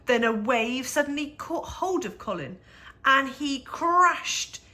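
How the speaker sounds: usual speaking voice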